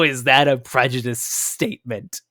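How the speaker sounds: normal talking voice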